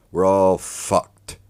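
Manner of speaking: slow, loud, high cry of doom; panicked outcry. Retold or imitated. Retelling the moment he saw the numbers, loud panicked cry. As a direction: drag it out like the world is ending.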